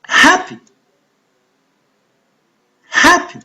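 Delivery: Happy with HAP high and strong; Happy, HAP-py, careful teaching demo; stressed first syllable. Shown as a demonstration. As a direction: punch the first syllable of Happy, tuck away the second. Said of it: "going to pronounce louder and longer".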